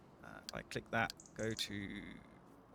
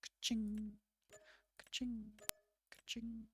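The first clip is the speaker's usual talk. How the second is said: sing-song ka-ching, higher, fading to a whisper; 9 semitones higher, 7 dB quieter, slower than usual. Pretend. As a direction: chirp it high, dying away. Celebrating payday.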